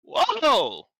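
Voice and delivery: high, loud whoa; booming Goliath. Acted. Goliath greeting someone walking by, boisterous. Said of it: "the Goliath goes".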